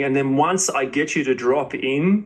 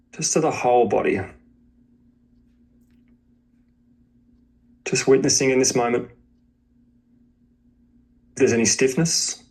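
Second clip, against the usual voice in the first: guided meditation; 4 semitones lower than usual. Acted. Teacher guiding a student to witness body and breath, meditation voice. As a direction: guide them gently into awareness of body and breath, leaving space between phrases.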